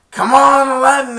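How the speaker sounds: high and loud, urging; the Genie begging his friend to wake up, loud and high; Genie yelling. Retold or imitated. Genie pleading with Aladdin, high and loud.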